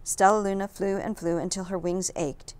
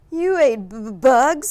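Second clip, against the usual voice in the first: shocked stammer on "b-, b-, bugs"; stuttering; 6 semitones higher, 11 dB louder, slower than usual. A narrator's reading. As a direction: trip over the word in disbelief. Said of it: "stuttered one".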